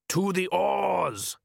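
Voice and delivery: high, slow call across water; long-vowelled rival's holler; shouted order. Acted. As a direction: call the crew to action, drawing it out. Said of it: "shouted someone in the other boat".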